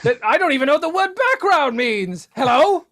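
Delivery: high, loud, bewildered put-on voice; a Civil War-era Englishman who doesn't know where he is; lost old-timey caller. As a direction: sound lost and startled by it all.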